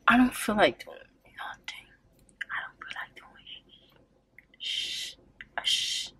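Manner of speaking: unvoiced whisper. Real, not acted. Telling viewers she is too lazy, whispered. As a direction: drop it to a whisper.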